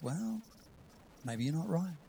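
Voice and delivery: soft, tentative inner nudge; hushed voice of second thoughts; little whispering voice. Acted. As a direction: quietly suggest it, as a doubt creeping in. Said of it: "And the voice says".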